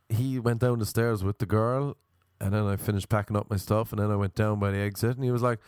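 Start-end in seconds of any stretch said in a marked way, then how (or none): none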